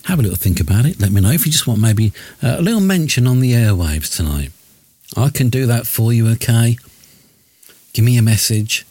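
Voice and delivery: ordinary talk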